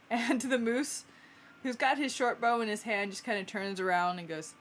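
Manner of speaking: ordinary talk, nothing marked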